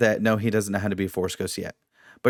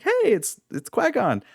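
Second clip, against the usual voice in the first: excited wife; 12 semitones higher than usual. Retold or imitated. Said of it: "Even my wife was like".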